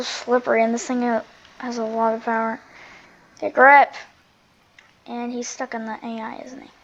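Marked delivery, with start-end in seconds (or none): 3.4-3.9 shouting. Real, not acted. Yelling at car in storm.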